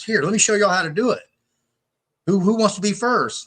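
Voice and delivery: eager instructor. Retold or imitated. Guy offering to show his friends how, eager. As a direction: imitate a keen guy taking charge.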